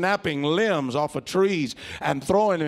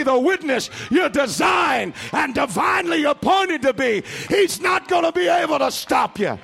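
building to a shout; 9 semitones higher, 6 dB louder than usual. Real, not acted. Sermon climax, building shouting.

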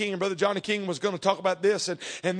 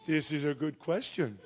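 slow, low, quiet admission; imitating a stumped man, low and drawn out; 6 semitones lower, 7 dB quieter than usual. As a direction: answer slowly and softly, caught without an answer. Stumped driver answers the question.

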